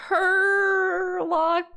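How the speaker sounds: "Herlock" with a long, drawn-out first syllable; trying out pronunciation. Real, not acted. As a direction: sound out "Herlock" slowly, stretching the "Her". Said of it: "How do you say that?".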